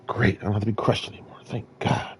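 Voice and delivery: fast, hushed relieved whisper; sighing thank-God whisper. Retold or imitated. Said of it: "I said".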